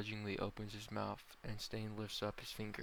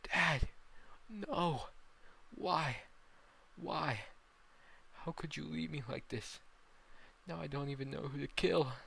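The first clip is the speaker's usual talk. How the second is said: high-pitched cries of loss; raised, grief-stricken wailing; anguished grief; 6 semitones higher, 3 dB louder than usual. Acted. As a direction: cry out as your father dies. Son cries out as father dies.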